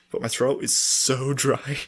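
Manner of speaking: ordinary talk, nothing marked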